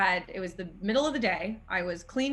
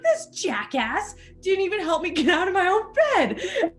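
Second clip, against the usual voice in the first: high, laughing outrage; high-pitched laughing indignation; outraged laughing rant; 9 semitones higher, 5 dB louder than usual. Retold or imitated. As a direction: vent at the useless roommate. Outraged at roommate.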